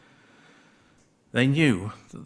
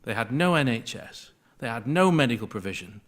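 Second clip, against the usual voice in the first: projected, with more force on NO; speaking up; 5 dB louder than usual. Real, not acted. Preacher repeating for those who could not hear, speaking up. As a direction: raise the volume to reach the room. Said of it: "Sorry, am I speaking too quietly?".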